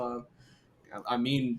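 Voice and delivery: usual speaking voice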